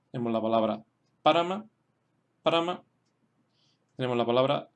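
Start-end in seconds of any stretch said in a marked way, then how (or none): 1.2-2.8 "Parama" accented on PA; teacher's careful "Parama", PA stressed; stress on first syllable. Shown as a demonstration. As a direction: model "Parama" with the weight on the opening PA. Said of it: "es la primera sílaba".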